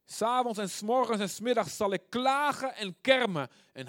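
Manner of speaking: high, forceful psalm cry; loud lament. Acted. Preacher voicing psalmist's lament to God, loud and fervent.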